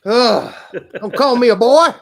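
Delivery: loud, high mock indignation; mock outrage. Friend calls him boy, he fires back in loud mock outrage. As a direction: bristle at being called boy, half joking.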